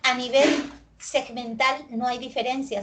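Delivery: ordinary delivery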